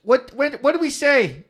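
hammy call to the rock; excited shouting. Pretend. Addressing the rock, mock Israelites.

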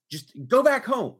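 loud, high, firm command; exasperated order. Retold or imitated. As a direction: order her home, fed up. Retold order to sister, exasperated.

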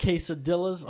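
quesadillas said like an American, kay-suh-DILL-uhz; gringo accent. Shown as a demonstration. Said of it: "Spanish stuff with a gringo accent".

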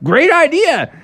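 high, loud happy cheer; squeaky cheer of delight; excited cheer. Acted. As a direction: cheer it like a whole family. Frog family cheers together.

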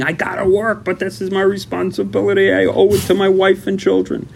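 high, insistent self-justifying plea; protesting. Acted. Pleading responsibility to wife and children, protesting.

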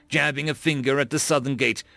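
ordinary delivery, nothing marked

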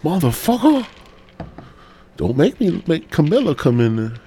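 put-on high, shrill old man snapping angrily; Joe Biden impression. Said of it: "Joe Biden would have been like".

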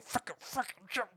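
high, strained, held-back curse; mock Joe Pesci. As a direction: squeak the swear out under your breath. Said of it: "When he had to go".

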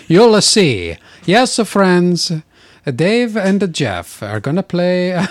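high, loud, badly faked bandleader accent; hammed-up accent, announcing to friends; [name]. Announcing that the two players will play, mock bandleader accent. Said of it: "suddenly it's sounding like [name]".

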